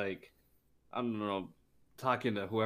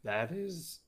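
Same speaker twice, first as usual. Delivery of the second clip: voice cracking; 6 semitones higher than usual. Real, not acted. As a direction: voice breaks upward mid-word. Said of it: "my voice cracked".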